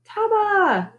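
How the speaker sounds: high, drawn-out call; yelling out. A narrator's reading. Boy calls his dog for help, yelling. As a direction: call the dog from afar without full volume. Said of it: "I yelled".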